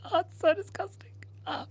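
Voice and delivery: high, unvoiced whisper of disgust. Real, not acted. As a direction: breathe it out, grossed out. Marker on nose, grossed out.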